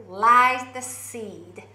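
lies the seed, with a firm clear L; careful L on lies; clear L sound. Shown as a demonstration. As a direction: start lies with a solid L. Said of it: "L、Lですけど".

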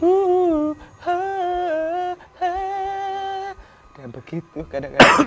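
high, loud eerie cries; changing ghostly voice. Retold or imitated. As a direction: shift into a strange high spirit voice. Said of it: "kadang berubah-berubah".

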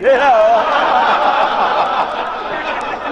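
loud rising laugh; big wheezy belly laugh; hearty laugh. Real, not acted. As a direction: burst out laughing.

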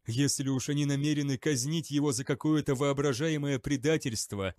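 plain reading voice

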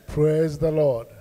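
usual speaking voice

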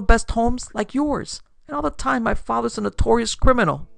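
high, loud, distressed confession; agitated outburst from a let-down daughter; upset daughter. A narrator's reading. Daughter upset over father's secret life.

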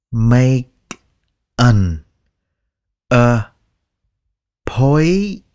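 each word slow and separate, appointment drawn out; slow dictionary reading. Shown as a demonstration. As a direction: read it slowly word by word like a dictionary. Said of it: "Cái đó là đọc chậm theo từ điển nha". (đọc chậm từng chữ như từ điển)

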